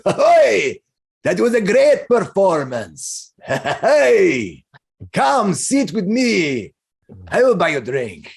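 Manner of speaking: high, loud, drawn-out hail from a jolly stranger; hearty role voice with stretched words; boisterous balding man. Acted. Balding man hails the performer at the bar, boisterous. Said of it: "you hear a voice from that balding man".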